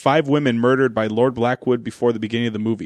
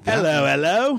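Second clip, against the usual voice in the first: cheery Cockney hello, pitched up; hammed-up Cockney greeting; Cockney accent; 4 semitones higher, slower than usual. Host playing a movie goon, hammed-up Cockney accent. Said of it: "like, Cockney accent".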